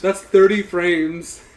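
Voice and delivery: laughing, slow and loud. Real, not acted. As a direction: laugh it out slowly. Laughing at game's frame rate.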